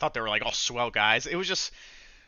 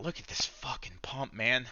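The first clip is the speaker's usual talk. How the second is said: hushed whisper to the viewers; whispered aside; 7 dB quieter than usual. Real, not acted. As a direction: whisper it in disbelief. Aside while showing the chart.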